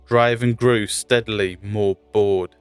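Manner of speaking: ordinary delivery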